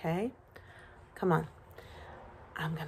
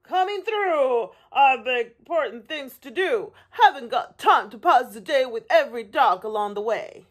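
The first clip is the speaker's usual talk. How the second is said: higher, hurried, bossy truck voice; yelling dump truck; 5 semitones higher, 4 dB louder than usual. A narrator's reading. Dump truck with important things to do, bossy call. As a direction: push through like a busy, impatient truck. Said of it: "yelled a dump truck".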